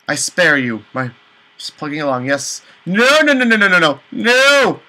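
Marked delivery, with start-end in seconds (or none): 2.8-4.8 panicked no. Real, not acted. Player panics at ghost fight.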